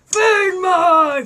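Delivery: loud shouting puppet voice; demanding puppet. Acted. Natasha the puppet, loudly demanding food. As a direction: shout it like a hungry spoiled puppet.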